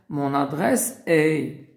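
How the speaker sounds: usual speaking voice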